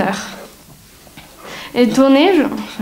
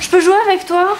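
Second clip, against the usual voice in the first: put-on pleading kid sister; 7 semitones higher, faster than usual. Little sister begging to play.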